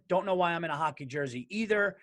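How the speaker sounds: ordinary delivery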